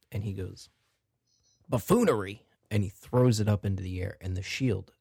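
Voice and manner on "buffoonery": sudden magic-word outburst; called-out command word. Acted. Shopkeeper throwing the shield into the air, called-out command. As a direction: exclaim it a little louder.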